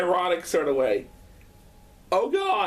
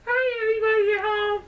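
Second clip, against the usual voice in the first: cat voice; 12 semitones higher than usual. Pretend. Owner voicing his cat greeting the viewers, high cat voice. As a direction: pretend the cat greets everyone. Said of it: "Say hi to everybody at home, Sparrow".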